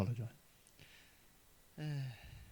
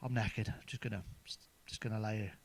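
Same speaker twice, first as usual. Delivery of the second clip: much softer, worn-out drooping voice; exhausted wife; 4 semitones lower, 10 dB quieter than usual. Retold or imitated. Worn-out wife flops into the chair after work, softly. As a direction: sag into the chair and sigh it out. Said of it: "She was knackered".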